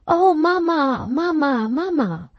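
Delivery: high, slow, fading plea; pleading cry. A narrator's reading. Rachel on her knees to her mother, fading tearful pleading cry. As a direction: sink down crying for mamma.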